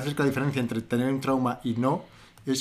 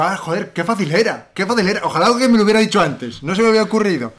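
mock-grateful sarcasm, louder and higher; sarcastic reply; 6 semitones higher, 7 dB louder than usual. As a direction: sarcasmo exagerado, como quien descubre lo obvio. (respuesta sarcástica, más alta y fuerte)

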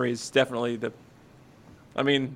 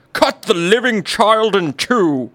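stern king's order; 5 semitones higher, 5 dB louder, slower than usual. Acted. King's command acted out.

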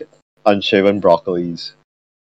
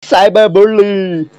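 mock whiny cry; 10 semitones higher, slower than usual. Joins the mock.

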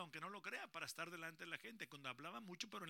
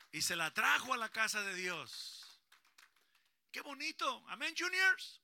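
loud, higher joyful praise; excited praise; 6 semitones higher, 12 dB louder than usual. Real, not acted. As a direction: lift the room with joy. Predicador celebra a voz alzada. (alabanza alegre y fuerte)

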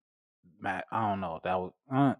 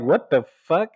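surprised; 7 semitones higher, 8 dB louder than usual. Retold or imitated. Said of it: "I was like".

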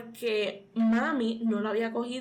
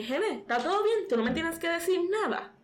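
imitating mom; 6 semitones higher than usual. Mother gently probing daughter.

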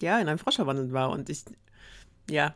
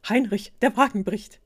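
loud, higher cry of alarm; panicked; 4 semitones higher, 6 dB louder than usual. A narrator's reading. Prince warns his servant of a breaking carriage, panicked. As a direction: call out a warning with mild panic. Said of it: "Panisch wandte er sich".

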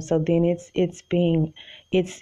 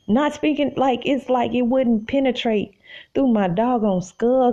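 normal talking voice

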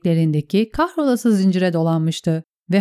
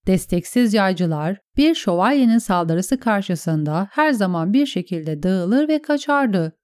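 ordinary delivery, nothing marked

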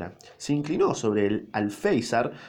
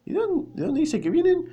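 startled question. A narrator's reading. Mr A suddenly surprised.

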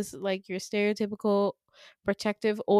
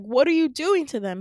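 louder, higher acted outburst; insistent demand; 5 semitones higher, 7 dB louder than usual. Retold daughter demanding answers from her father, forceful and insistent. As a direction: press the question hard, dramatized.

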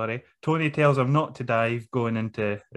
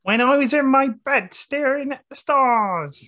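much higher, louder, starry-eyed; dreamy rookie impression; 12 semitones higher, 4 dB louder than usual. Host mocking Fraser's dreamy promo, much higher. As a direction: go way over the top, sighing at the stars. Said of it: "What was that?".

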